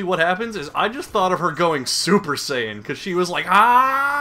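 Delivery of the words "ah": long, high, loud rising yell; power-up scream held and climbing, mocking; imitated scream. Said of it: "then she starts screaming".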